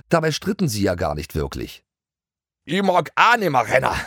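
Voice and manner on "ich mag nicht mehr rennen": higher, louder Bavarian pony whine; Bavarian complaining. A narrator's reading. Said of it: "beschwerte sich Toni". (jammernd auf Bairisch, höher und lauter)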